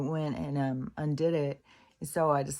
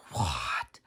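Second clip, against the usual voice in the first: stunned reaction; 12 semitones lower than usual. Retold or imitated. Reacting to moldy car smell.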